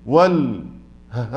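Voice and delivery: ordinary talk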